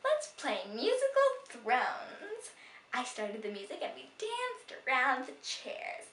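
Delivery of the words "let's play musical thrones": high, slow grand proclamation; grand announcement. Acted. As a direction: announce it like a herald.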